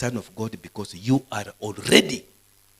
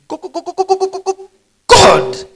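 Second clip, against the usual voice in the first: high, loud stuck "go, go, go" then "God"; mock stammer pushed up high and loud; stammering Moses; 15 dB louder than usual. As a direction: stutter the message to Pharaoh. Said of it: "Moses was a stammerer".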